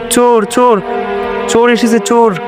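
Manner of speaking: high-pitched cry of alarm. Acted. Household alerted to a thief, high alarmed calling.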